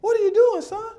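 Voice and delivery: very high, incredulous question; comic high-pitched disbelief; baffled coach. Retold or imitated. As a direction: ask the kid in squealed bewilderment. Baffled coach questions searching child.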